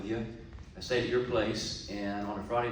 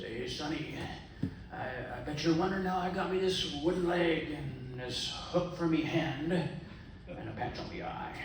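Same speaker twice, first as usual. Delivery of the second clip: pirate voice; 5 semitones higher than usual. Acted. Old pirate telling the young man at the bar, in a hammed-up pirate voice.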